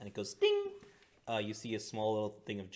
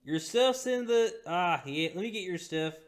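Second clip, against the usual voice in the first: Stink Eye's voice; 9 semitones higher, 8 dB louder than usual. Acted. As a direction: hand over the gear in character. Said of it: "this accent's got lost on me".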